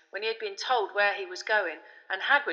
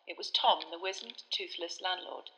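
usual speaking voice

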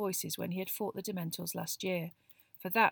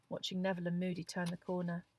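ordinary delivery, nothing marked

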